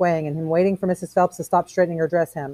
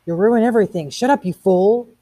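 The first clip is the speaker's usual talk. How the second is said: louder, higher, slowing on the insult; urgent scolding; 5 semitones higher, 6 dB louder than usual. A narrator's reading. Faber afraid Montag will ruin everything, scolding loudly. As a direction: scold him with urgent force. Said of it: "Faber's voice scraped away at him".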